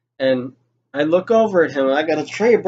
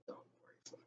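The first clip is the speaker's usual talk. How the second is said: soft breathy whisper; 39 dB quieter than usual. Retold or imitated. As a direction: barely breathe it out. Reassuring R after ordeal.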